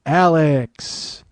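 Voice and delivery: stretched-out stage introduction, name held long; club announcer. Retold or imitated. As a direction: imitate an announcer bringing on a dancer. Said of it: "so the announcers".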